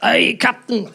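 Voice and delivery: hammed-up imitation Scots; mock Scottish accent; fake Scottish accent. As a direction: bluff on in a put-on Scottish voice. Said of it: "keep talking like that".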